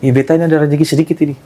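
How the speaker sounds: quick Ambonese dialect line; quick Ambon accent. Retold or imitated. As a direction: rattle it off like a local from Ambon. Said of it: "Pakai logat Ambon kan".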